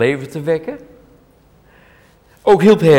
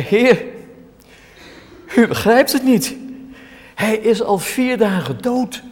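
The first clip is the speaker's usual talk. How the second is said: pleading Martha; 10 semitones higher than usual. Acted. Preacher voicing Martha to Jesus, high pleading protest. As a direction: beg the Lord to understand, voice pitched up high.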